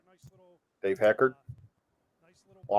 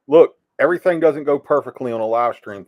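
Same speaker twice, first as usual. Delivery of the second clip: ordinary talk, nothing marked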